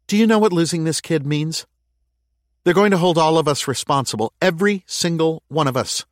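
high, loud, rushing fear; anxious mayor. Acted. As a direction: spill the worry fast. Lana frets aloud.

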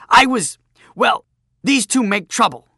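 loud, high, faltering protest; flustered bluster. Acted. Bully losing to the girl, loud flustered protest. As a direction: bluster loudly while hunting for an excuse.

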